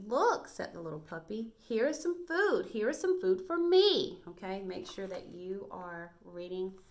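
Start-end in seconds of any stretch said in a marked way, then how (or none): 0.1-0.5 high, loud excited little puppy; talking like the puppy. Shown as a demonstration. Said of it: "talking like the puppy". 1.6-4.2 talking like the puppy. Shown as a demonstration. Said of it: "talking like the puppy".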